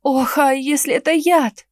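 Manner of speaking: громче и выше, в панике; испуганно зовёт раненого; панический крик. Acted. Героиня в панике над Генрихом. (паника, испуганный крик)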